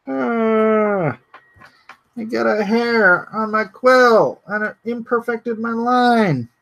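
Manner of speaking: put-on high, slow fussy old man; lightly voiced prissy scribe; fussy old-timey voice. Fussing over a quill line.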